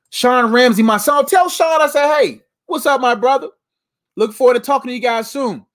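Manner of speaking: high, loud, delighted greeting; excited shout-out. Real, not acted. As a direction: call out to a friend with joy. Shouting out a live viewer.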